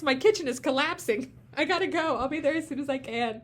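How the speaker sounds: harried retelling. Retold or imitated. Piper to Phoebe as the kitchen collapses, harried. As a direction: play up the kitchen panic a little. Harried character on a phone call.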